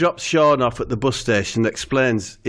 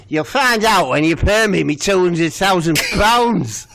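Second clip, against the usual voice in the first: voicing the lass; 6 semitones higher, 4 dB louder than usual. Lass threatening Steve.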